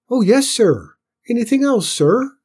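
high, obedient pipe; small squeaky Cinders. A narrator's reading. Cinders answers cross Tim, small high voice. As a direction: answer high and loud, eager to obey.

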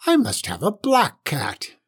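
high, insistent witch screech; shrieking witch. A narrator's reading. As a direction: insist crossly, pitch swooping up. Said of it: "shrieked the witch".

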